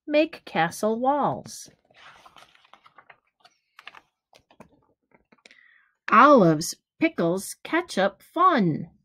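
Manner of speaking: gentle storybook read-aloud, each rhyme given room; reading to children. Acted. Teacher reading a picture book to kids, lively storytelling. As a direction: read it like bedtime story time for little ones.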